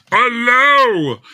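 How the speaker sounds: high, loud exasperated Allow!; exasperated shout. Real, not acted. Streamer losing patience with the popup, shouting. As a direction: bark Allow! at the stubborn dialog box. Frustrated at dialog.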